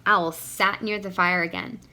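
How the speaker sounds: ordinary delivery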